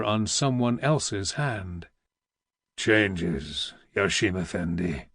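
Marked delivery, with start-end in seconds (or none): 2.8-5.1 put-on deep, gruff old pasha growl; low growl. A narrator's reading. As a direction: growl it like a weary old vizier. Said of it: "the old Pasha growled".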